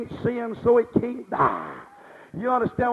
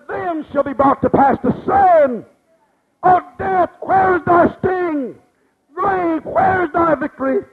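higher, louder, ringing scripture cry; triumphant preaching; 6 semitones higher, 6 dB louder than usual. Acted. Preacher crying scripture to the congregation, loud and triumphant. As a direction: lift it up like a victory shout.

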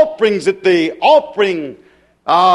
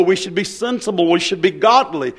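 ordinary talk, nothing marked

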